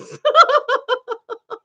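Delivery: very high run of laughter; hilarity. Real, not acted. Finds it hilarious.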